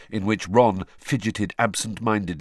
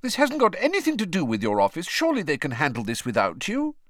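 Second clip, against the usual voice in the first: high, pressing motherly protest; a mother objecting, voice up high; Molly Weasley voice; 13 semitones higher, 4 dB louder than usual. Acted. Molly scolds Arthur.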